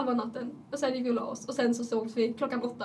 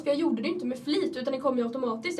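ordinary delivery

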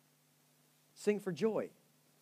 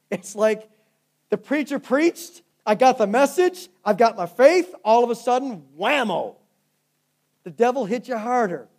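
loud, high, building preacher cry; fiery sermon climax; preaching at full force; 4 semitones higher, 10 dB louder than usual. Real, not acted. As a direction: drive it home to the congregation. Preaching climax, rising intensity.

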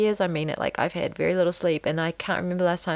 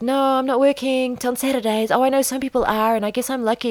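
high-pitched, eager-to-placate excuse; apologetic; 6 semitones higher, 4 dB louder than usual. Retold or imitated. Placating a neighbor who asked about work, apologetic. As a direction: lift the voice and hurry to excuse yourself. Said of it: "be apologetic for".

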